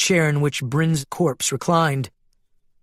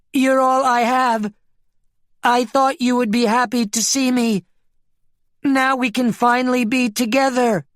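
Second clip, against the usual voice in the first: high-pitched, yearning sheep voice; desperate pleading; 10 semitones higher, 3 dB louder than usual. Acted. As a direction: implore the one you love, high and urgent. Sheep begs Giles, walking toward him.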